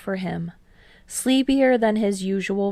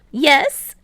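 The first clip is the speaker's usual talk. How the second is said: put-on higher, louder boyish teammate; Hinata, eager; 7 semitones higher, 8 dB louder than usual. A narrator's reading. Hinata bragging to Kei about deflections, higher, louder and eager. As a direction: bounce up, brag a bit.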